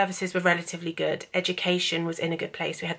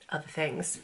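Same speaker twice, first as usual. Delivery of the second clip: ordinary delivery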